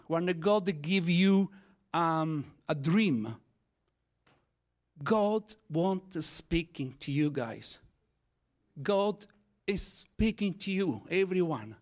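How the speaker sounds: usual speaking voice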